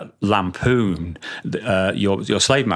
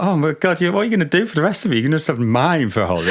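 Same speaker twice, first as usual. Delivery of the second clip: amused dismay; 7 semitones higher, faster than usual. Retold or imitated. Retold shock at drummer's broken skin.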